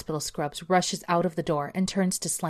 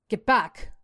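booming command; 5 semitones higher than usual. Acted. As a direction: order them back through a megaphone. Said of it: "booms the voice of the watch commander through the megaphone".

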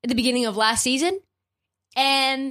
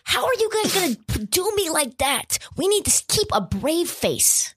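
higher, fast, exasperated exec rant; mock furious Morey; 7 semitones higher than usual. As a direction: fake outrage at his own coach and star. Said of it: "He was like".

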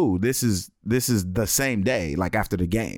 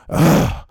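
loud fed-up exhale; exasperated ugh sigh; annoyed sigh; 7 dB louder than usual. Retold or imitated. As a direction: huff like an annoyed wife. Wife's annoyed sigh retold.

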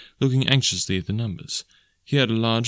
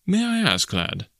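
bland; 8 semitones higher, slower than usual. A narrator's reading. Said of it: "I said in the blandest voice".

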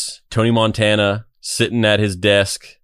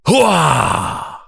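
loud gung-ho soldier; 9 semitones higher, 3 dB louder than usual. Retold or imitated. Meme caption ends with war cry.

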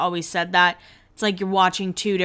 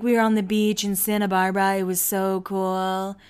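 trying hard to sound casually cool; serious, playing it cool. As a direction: imitate someone underplaying their happiness. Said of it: "that's how she talks when she's trying to be, like, serious".

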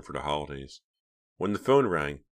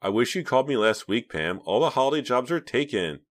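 fake upset; 8 semitones higher, 5 dB louder than usual. A narrator's reading. Reva lying to her cousin, faking being upset. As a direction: put on sympathy she doesn't feel. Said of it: "making herself sound really upset".

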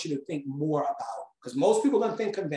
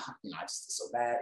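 mumbled confession; 7 semitones lower, 10 dB quieter, faster than usual. Retold or imitated. Confessing to mentor at dinner.

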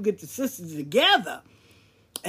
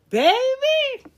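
excited exclamation; 10 dB louder than usual. Real, not acted. Storyteller's exclamation at the climax.

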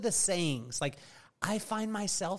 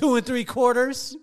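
louder, higher warning count; stalling parent countdown; 8 semitones higher, 9 dB louder than usual. Retold or imitated. Father running out of numbers before three, loud stalling warning. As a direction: imitate a parent desperately stretching the count. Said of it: "like every other parent".

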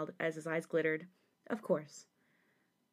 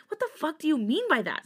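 loud, high-pitched furious question; angry snarl; 9 semitones higher, 6 dB louder than usual. A narrator's reading. Sungmin challenges Jisung's blame, snarling. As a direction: demand an answer, pitch leaping. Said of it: "Sungmin snarled".

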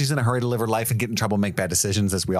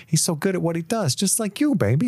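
mock sweet girl voice, much higher; girlish voice; 11 semitones higher than usual. Imitating Mabel flattering Richie in a high coo. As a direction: tease him in a high sugary coo. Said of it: "she says something like".

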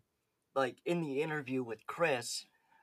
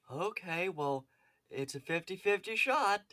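Jeff; 5 semitones higher than usual. Retold or imitated. Podcaster mimics the man chancing it, high impression. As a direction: mimic him with a high, game little voice. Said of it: "he's just like".

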